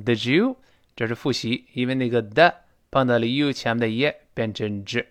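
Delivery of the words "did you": "Did you" blended into didjoo; didjoo, the d and y fused; linked Did you. Shown as a demonstration. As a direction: run "Did you" together with a j sound. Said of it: "放在一块儿读成".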